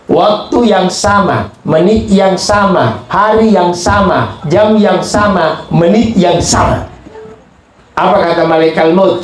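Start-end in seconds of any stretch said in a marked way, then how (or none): 0.1-6.9 each phrase weighted and slowed, falling at the end; slow emphatic listing. Acted. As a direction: spell out every unit of time, drawn out. Preacher stresses each time unit. (mengucapkan pelan dan ditekan satu per satu)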